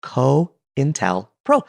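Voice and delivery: "cointelpro" stretched very slowly with a rise at the end; slow careful word. Shown as a demonstration. Teaching listeners the new word, very slow. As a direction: drag every syllable of "cointelpro", ending up. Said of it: "T E L P R O".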